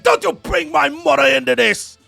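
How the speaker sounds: high, loud mobster outburst; outraged shout. Acted. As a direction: yell it like an affronted mama's boy don. Quoting Tony's outburst over his mother.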